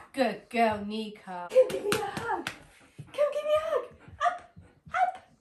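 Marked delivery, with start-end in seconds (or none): 1.5-5.3 high, loud coaxing; squeaky coaxing. Real, not acted. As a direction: coax the dog up for a hug. Coaxing the dog for a hug.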